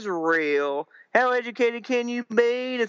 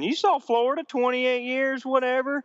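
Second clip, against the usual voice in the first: ordinary delivery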